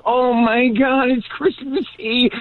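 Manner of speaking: high-pitched imitation of panicked self; mock panic. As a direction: squeal in holiday horror. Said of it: "and I'm like".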